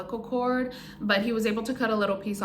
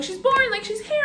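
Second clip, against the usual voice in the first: high, bright mock nurse announcing the birth; imitating an excited nurse, much higher; excited announcement; 13 semitones higher, 3 dB louder than usual. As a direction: pitch it way up like a cheerful nurse announcing. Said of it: "and they're like".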